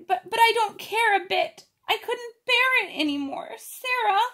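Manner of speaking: put-on high, pleading girlish voice; a crying child who can't bear it anymore; tearful Ermengarde. A narrator's reading. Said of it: "she said".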